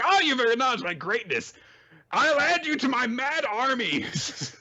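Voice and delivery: high, hammed-up grandiose squeal; power-drunk character. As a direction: proclaim it like a tiny tyrant. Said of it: "the power goes to his head".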